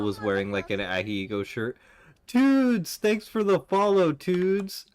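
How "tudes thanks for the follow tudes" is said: excited shout-out. Real, not acted. Excited thanks for a new follower.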